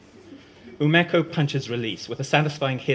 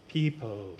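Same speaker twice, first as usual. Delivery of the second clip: softer, calming call to the group; soothing; 2 semitones lower, 7 dB quieter than usual. Acted. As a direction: settle everyone down gently. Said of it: "soothes Ash".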